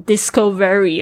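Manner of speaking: discovery mispronounced as "disco-very"; discovery split into DISCO and VERY; wrong pronunciation. Shown as a demonstration. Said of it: "千万不要念成discovery".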